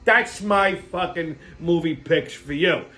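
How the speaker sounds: ordinary talk, nothing marked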